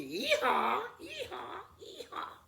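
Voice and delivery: loud, high braying yee-haw fading to a breath; donkey bray. Acted. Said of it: "it would bray like a donkey".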